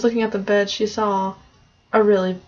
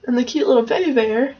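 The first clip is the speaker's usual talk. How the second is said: ordinary delivery, nothing marked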